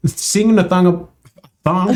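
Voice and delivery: slight lisp turning s into th. Real, not acted. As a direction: lisp on the s sounds. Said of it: "You're lisping?".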